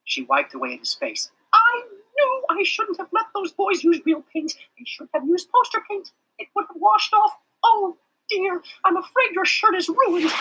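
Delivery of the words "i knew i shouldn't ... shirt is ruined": high, flustered teacher voice; worried schoolteacher, fussing over him; fretting teacher. A narrator's reading. Teacher Miss Roop voiced.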